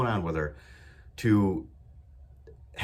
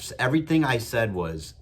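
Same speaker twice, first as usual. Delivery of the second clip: ordinary talk, nothing marked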